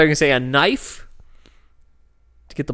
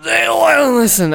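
higher and louder booming voice; high booming dwarf voice; 12 semitones higher, 6 dB louder than usual. Acted. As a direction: boom it like a dwarf. Repeats the quote in a voice.

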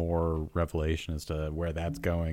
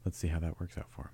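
golf commentator voice; 3 semitones lower, 8 dB quieter, faster than usual. Pretend. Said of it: "keeps the commentator voice".

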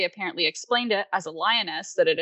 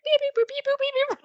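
high chirpy birdsong beeps; bird chirping imitation. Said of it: "it's like".

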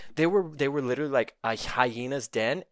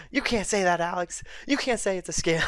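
mocking hecklers; 7 semitones higher than usual. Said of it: "They're all nipping at me".

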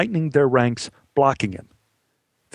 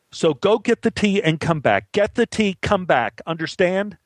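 higher-pitched, insistent command; bossy order; 7 semitones higher than usual. Acted. Assistant director sending the boy for tea, bossy. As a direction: drum the instruction into him.

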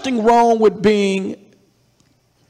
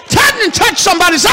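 fast fired-up urging; 8 semitones higher, much faster than usual. Real, not acted. Preacher urging the congregation to touch a neighbour, fast and shouted. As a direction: whip the crowd up.

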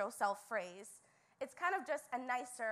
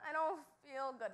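mock whine; 3 semitones higher than usual. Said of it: "let everyone and anyone know".

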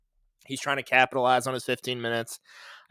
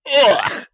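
loud, high "ugh" of disgust; disgusted groan; 13 semitones higher, 10 dB louder than usual. Retold or imitated. Host acting out hearing a bad song, disgusted groan. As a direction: recoil with a sharp, loud groan.

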